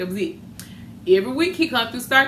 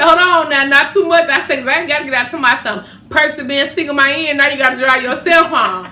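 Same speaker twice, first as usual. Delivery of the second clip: higher, louder playful sass; sassy comeback; 4 semitones higher, 6 dB louder than usual. Retold or imitated. Daughter teasing her mother about being single, loud and sassy. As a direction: tease her with a grin in the voice.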